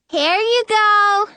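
put-on high, slow little-girl voice. A narrator's reading. Disguised queen offers Snow the plate, little-girl voice. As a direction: hand it over in a drawn-out child's singsong. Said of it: "the little girl says".

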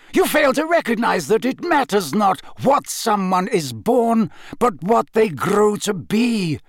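commanding, urgent oratory; voice rising. A narrator's reading. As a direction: drive each point home with conviction. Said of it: "his voice rising now".

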